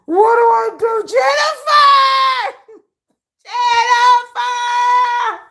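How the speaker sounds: high, loud mock wail for help; hammed-up panic calling a friend. Panicking over a man's message, shrieking for Jennifer. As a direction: shriek her friend's name in mock terror. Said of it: "I'm like".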